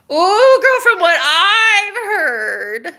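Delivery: high, loud, long-stretched squeal; sing-song scandalized delight; gleeful gossip. Real, not acted. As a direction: draw every word out in a soaring, teasing whoop.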